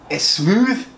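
a light put-on Scottish accent; taste-test in a Scottish brogue. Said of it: "in a Scottish accent".

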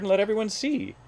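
high calling voice; crying out. A narrator's reading. Hornbill calls Hubert before his friends, crying out. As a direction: coax loudly, pitched high. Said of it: "cried the bird".

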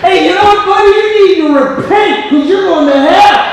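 high, loud, mocking hellfire preacher; mock pushy preacher. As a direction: sneer the warning like a preachy know-it-all.

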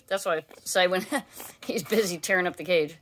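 ordinary delivery

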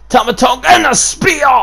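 big, loud, high call of the spear; excited shout. Real, not acted. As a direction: yell it like the crowd just erupted. Commentator calls a big move.

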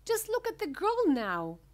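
high, loud distressed outcry; cry out. A narrator's reading. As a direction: cry it out as an appalled mother. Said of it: "cried Missus Beauregard".

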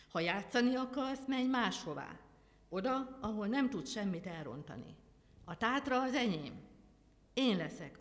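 angry rebuke. A narrator's reading. The Lord angrily sends the angel away, loud. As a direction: drive the angel away in anger. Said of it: "dörgött rá most először mérgesen".

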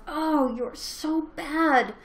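loud, high, hammed-up self-scolding; acted-out inner critic, high and loud; scolding self-talk. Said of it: "I'm telling myself".

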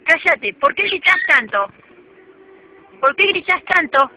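loud firm telling-off; stern scolding. Real, not acted. Owner loudly scolding her screaming parrot. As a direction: clamp down on a screeching bird.

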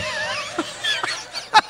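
very high, softening peal of laughter; laughing. Real, not acted. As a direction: laugh on until it fades. Laughing fit continues.

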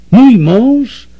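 cried out high and loud in utter disbelief; as if the floor dropped away; shocked cry. A narrator's reading. Mrs Weldon crying out on hearing her husband is the buyer. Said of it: "krzyknęła pani Weldon".

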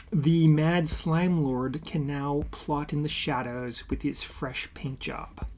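ordinary talk, nothing marked

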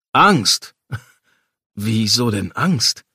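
loud, incredulous echo with a laugh; amused disbelief. Acted. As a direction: burst out in amused surprise, then slow down.

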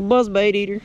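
usual speaking voice

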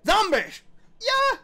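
high, loud exclamation; excited shout. Real, not acted. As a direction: yell out at the zombies. Exclaims at zombies.

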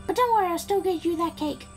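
high put-on princess voice; Peach. Princess promises Mario his cake, put-on high voice. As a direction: reassure in a high mock-damsel voice. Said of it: "Peach is like".

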